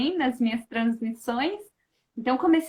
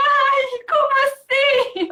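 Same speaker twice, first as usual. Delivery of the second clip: much higher, louder tearful mother; mother crying; 12 semitones higher, 6 dB louder, slower than usual. Retold or imitated. The mother crying beside her, high and tearful. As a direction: play the weepy mother gasping in disbelief.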